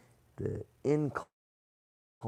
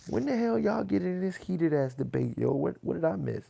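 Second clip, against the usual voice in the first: high, louder startled what-the; mock surprise; 7 semitones higher, 4 dB louder than usual. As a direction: act baffled, voice up high. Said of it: "I was like".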